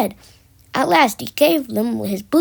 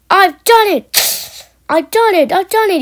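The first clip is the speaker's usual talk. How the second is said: triumphant; 7 semitones higher, 6 dB louder than usual. A narrator's reading. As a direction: crow with victory. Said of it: "he puffed triumphantly".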